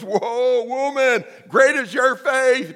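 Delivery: high, slow, amazed exclaim. Retold or imitated. Jesus amazed at the Canaanite woman's faith, high slow exclaim. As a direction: marvel at her faith. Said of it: "And he goes".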